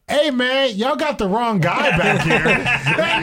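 high, slow and laughing through it; cracking up as he quotes the dumbfounded classmate; laughing while quoting. Retold or imitated. Storyteller laughing while voicing his classmate in the cop car. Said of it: "and he said".

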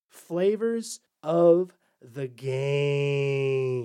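slow, drawn-out announcer slogan. Acted. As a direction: stretch it like an ad tagline.

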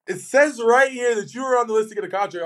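high, put-on voice of the exec reading the list; mimicking the team exec. Player imitating the exec insisting he was on the contract list, high put-on voice. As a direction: read it off like a puzzled front-office man. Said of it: "He goes".

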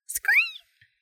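very high, piercing little squeak; happy high-pitched bat cry; cheerful bat screech. A narrator's reading. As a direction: call out a bright bat "screet". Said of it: "Dean called cheerfully".

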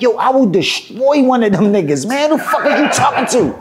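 high, heated retold threat; getting mad. Rapper defending his skills to [name], heated and high-pitched. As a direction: boast and challenge, speeding up at the end. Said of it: "now I'm getting mad".

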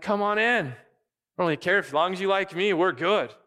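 high, loud breezy welcome; careless host. Acted. Preacher mocking an easygoing host, high breezy voice. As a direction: brush it off cheerily.

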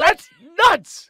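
high and loud, astonished; stunned exclamation; flabbergasted. Real, not acted. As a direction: blurt out pure disbelief. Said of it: "I've been flabbergasted before".

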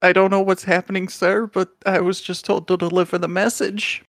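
high little mouse voice, hurried then halting; a small creature recounting the errand; nervous mouse messenger. Acted.

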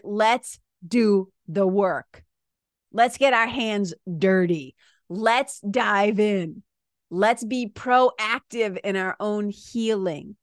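slow, punched rallying call; rally them word by word; emphatic rallying. Retold or imitated. Coach urges clients to do the work, slow and emphatic. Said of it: "I'm like".

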